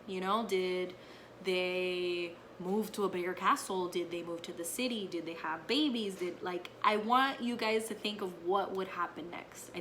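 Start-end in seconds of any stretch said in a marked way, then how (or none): none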